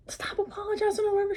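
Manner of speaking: squeaky mock-up of the people around her, softly; mock high quote. Imitating friends scolding her apologies, in a high soft mock voice.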